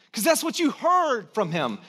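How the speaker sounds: normal talking voice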